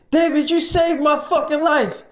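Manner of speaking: overwhelmed thanks, near tears; crying gratitude. Retold or imitated. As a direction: Mike thanking David for saving his life. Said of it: "He started crying and stuff".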